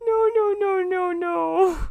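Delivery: high, loud, real anguished wail of no; horrified no. Real, not acted. Streamer reading fanfic on stream, real horrified wail. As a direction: cry out in real horror at what you just read.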